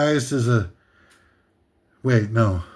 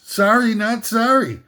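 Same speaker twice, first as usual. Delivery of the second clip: mock apology; 7 semitones higher than usual. Defiant quip after insult.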